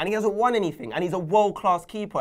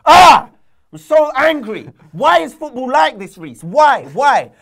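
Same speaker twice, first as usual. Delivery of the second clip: hammed-up furious shouting, higher and louder; over-the-top shouted football rant; mock rage; 8 semitones higher, 11 dB louder than usual. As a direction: rant like a betrayed fan yelling at the sky. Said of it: "Ugh, I haven't done this in a while".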